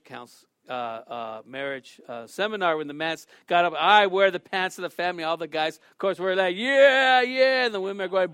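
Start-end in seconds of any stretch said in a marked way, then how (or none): 3.8-5.2 a touch louder, puffed-up announcement; proud boast. Retold or imitated. Man boasts at seminar. 6.6-7.7 higher, louder cheer from the guys; cheering crowd. Retold or imitated.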